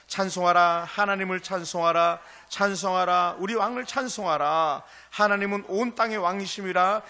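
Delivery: loud reading. Acted. Congregation reads psalm aloud together.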